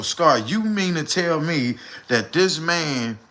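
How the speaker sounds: usual speaking voice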